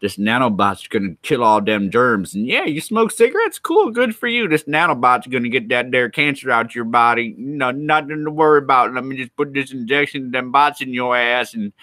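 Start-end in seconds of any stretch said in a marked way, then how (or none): 2.3-11.7 high, bright sales pitch voice, hammed-up; mock pushy salesman. Streamer mocks a salesman pushing nanobot injections, hammed-up and high. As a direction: sell it like a sleazy infomercial doctor.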